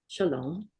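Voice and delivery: ordinary talk, nothing marked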